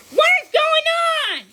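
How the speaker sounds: loud, high startled yell. A narrator's reading. Sheriff hearing the crash from the other room, loud high yell. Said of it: "he yelled".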